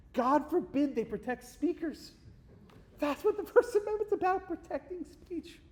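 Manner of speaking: sarcastic. Real, not acted. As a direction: drip sarcasm, drawing out the words up high. Sarcastic comment on protesters' demand.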